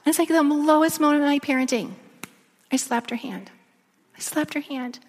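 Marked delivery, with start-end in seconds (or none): 2.7-5.0 soft, remorseful admission; quietly ashamed; hushed confession. Real, not acted. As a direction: drop your voice, owning up to it. Painful confession of lowest moment.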